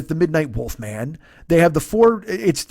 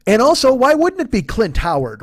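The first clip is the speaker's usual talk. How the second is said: ordinary talk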